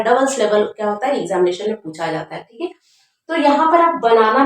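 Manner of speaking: usual speaking voice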